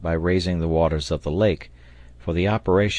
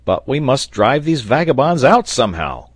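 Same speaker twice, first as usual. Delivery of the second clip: loud, higher-pitched urgent exclamation; sailor's cry; 6 semitones higher, 7 dB louder than usual. A narrator's reading. Sailor urging companions to drive out the intruders, crying out. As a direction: cry it out forcefully, voice lifted. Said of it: "cried the sailor".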